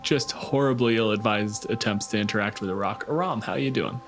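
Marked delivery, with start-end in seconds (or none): none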